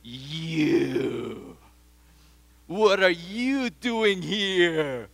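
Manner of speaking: slow, drawn-out angry challenge; indignant challenge. Acted. John confronting the Pharisees, slow and indignant. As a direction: glare and demand it slowly. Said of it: "your blood starts boiling".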